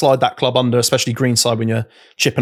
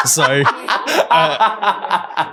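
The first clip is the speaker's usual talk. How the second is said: laughing; 7 semitones higher than usual. Real, not acted. Laughing at own first-time joke.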